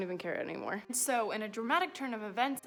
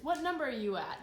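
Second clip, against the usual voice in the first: ordinary talk, nothing marked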